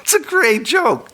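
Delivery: high, slow, giggling words; laughing. Real, not acted. Host repeats praise of his joke, giggling.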